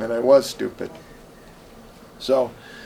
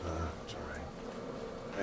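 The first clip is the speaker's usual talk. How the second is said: much softer, lower, slow mumble; sheepish reply; 10 semitones lower, 19 dB quieter, much slower than usual. Retold or imitated. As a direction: barely give it, embarrassed. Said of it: "And he goes".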